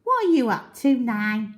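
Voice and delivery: very high, chirpy little monkey; high cheeky monkey greeting. Acted. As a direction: squeak a friendly hello up in the tree. Monkey questions crocodile.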